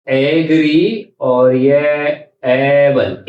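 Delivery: एग्री and एबल said very slowly, apart; slow syllable split. Shown as a demonstration. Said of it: "इस तरह ये हम लिखेंगे". (बहुत धीरे, शब्दांश अलग)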